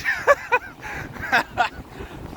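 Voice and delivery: a burst of high, airless laughter; breathless high laugh. Real, not acted. Tired on the walk, laughing.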